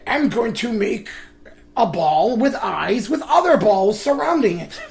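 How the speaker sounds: high, slow, giggly scheme; laughing villain voice. Retold or imitated. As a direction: plot it while laughing. Mock Eggman, laughing.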